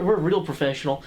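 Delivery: ordinary delivery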